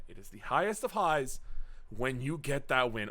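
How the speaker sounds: usual speaking voice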